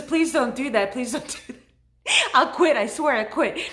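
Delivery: high, loud plea breaking into laughter; retold begging, half lost in giggles; mock pleading, laughing. Nurse begs the patient not to fall, laughingly retold.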